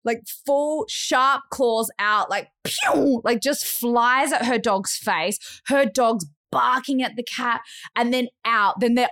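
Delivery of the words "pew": higher-pitched launching "pew"; whoosh sound effect. Retold or imitated. Storyteller imitating the cat launching at a dog, whoosh. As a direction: make a cartoon projectile noise.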